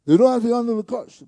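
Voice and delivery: loud and fast, punching "don't". Shown as a demonstration. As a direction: hammer it out quick and forceful. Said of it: "Maybe don't say it like that".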